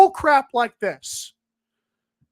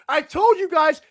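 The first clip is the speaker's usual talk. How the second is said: usual speaking voice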